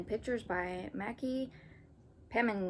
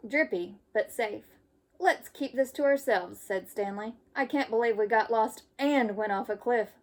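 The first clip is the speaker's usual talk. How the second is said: lively storybook read-aloud; reading to children; 3 semitones higher, 5 dB louder than usual. Acted. Teacher reading a camping story to kids. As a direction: read it brightly for young listeners. Reads picture book to children.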